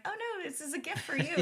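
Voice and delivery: a giddy laugh while correcting him. Retold or imitated. Fan laughing as she tells Jerry it's a gift.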